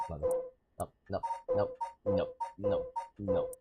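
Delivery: ordinary talk, nothing marked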